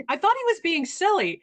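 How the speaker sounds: ordinary delivery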